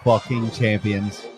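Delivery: loud, triumphant celebration yell; excited shouting. Real, not acted. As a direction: roar it out like you just won the title. Celebrating a national championship.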